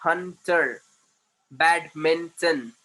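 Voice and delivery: "Hunter, badminton, systematic" each broken into distinct syllables; cut into syllables. Shown as a demonstration. Teacher shows students how to present homework, syllable by syllable. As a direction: say each word in separated beats, a gap after every syllable. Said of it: "syllable में cut करके बोलना".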